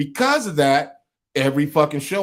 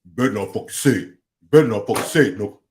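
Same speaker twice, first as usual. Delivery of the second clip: mock threatening co-host; 4 semitones lower, 3 dB louder than usual. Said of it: "you sat across".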